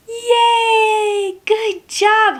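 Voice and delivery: high-pitched drawn-out praise; bright sing-out of celebration, high; excited cheer. Pretend. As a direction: cheer on a small child. Cheering child for feeding horse.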